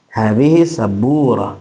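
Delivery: slow falling statement; "Hadhihi sabura" drawn out as information; informative intonation. Shown as a demonstration. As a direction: state "Hadhihi sabura" slowly, dropping at the end. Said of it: "sebagai informasi".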